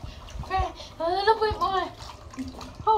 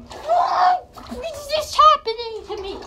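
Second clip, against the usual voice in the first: shocked shout; 6 semitones higher, slower than usual. Real, not acted. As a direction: yell out in shock at the cold. Reacting to the ice water.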